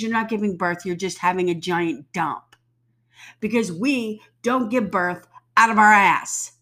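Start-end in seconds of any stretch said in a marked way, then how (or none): 3.4-6.5 louder, emphatic rant line; loud exasperated rant. Real, not acted. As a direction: push it out exasperated. Ranting at pretenders.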